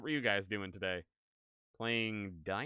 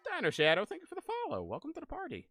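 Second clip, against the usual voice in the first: high cute thanks; faster than usual. Real, not acted. Thanking new follower.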